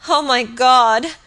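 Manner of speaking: loud, slow, high exclamation; horrified cry. A narrator's reading. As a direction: draw out a cry of horror. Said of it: "she cried".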